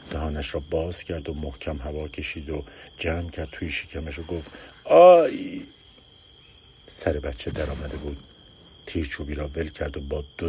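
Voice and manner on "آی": high loud cry of pain. Acted. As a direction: yell out from the pain. فریاد درد زایمان. (فریاد بلند درد)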